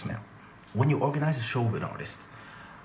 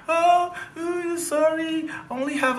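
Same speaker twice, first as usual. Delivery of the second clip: mock crying; slower than usual. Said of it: "start crying".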